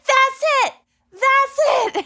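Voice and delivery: high thrilled shout, repeated; joyful yell of discovery; excited shout. Retold or imitated. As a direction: cry it out like you just spotted it. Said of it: "and I was like".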